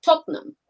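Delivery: "Tottenham" as two syllables, TOT-num; local pronunciation. Shown as a demonstration. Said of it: "that's how they say it".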